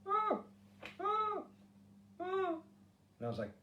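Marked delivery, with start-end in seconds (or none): 0.1-2.5 very high squeaky ah cries, one at a time; mouse in a trap. Retold or imitated. Imitating his woman's squeaks heard in the night. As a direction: squeak each ah like a trapped mouse. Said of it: "She sounded like a mouse caught in a trap".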